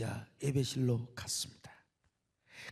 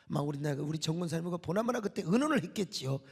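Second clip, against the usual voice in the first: usual speaking voice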